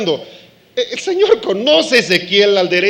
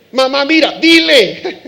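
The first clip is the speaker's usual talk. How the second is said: put-on higher, louder insistent kid; childish demand to mom; tattling child; 6 semitones higher, 7 dB louder than usual. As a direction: urge mom to scold, childish whine.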